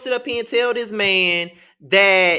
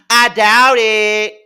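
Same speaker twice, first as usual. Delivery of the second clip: drawn-out scoff; 9 dB louder, much slower than usual. Real, not acted. Scoffing at Portia's school claim.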